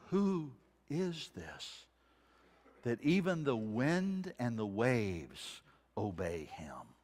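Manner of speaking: slow, breathless whisper; awed whisper. Acted. Disciples to one another, an awed whisper. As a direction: whisper it in wonder.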